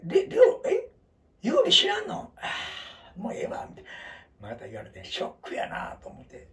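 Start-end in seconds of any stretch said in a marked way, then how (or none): 0.0-3.7 high, loud disbelieving squeal; mock astonishment. Friend Gurume, shocked he doesn't know Leo, squealing high. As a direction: act stunned he's never heard it.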